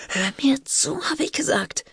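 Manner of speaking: urgent, high, hushed insistence. A narrator's reading. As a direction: insist under your breath, pitched high. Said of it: "Ihre Stimme klang dringlich". (dringlich, mit hoher, gedämpfter Stimme)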